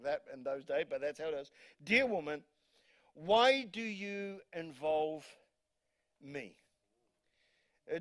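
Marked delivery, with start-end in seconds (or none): 1.8-6.5 slow scripture read. Retold or imitated. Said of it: "scripture read".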